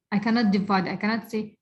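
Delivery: ordinary talk, nothing marked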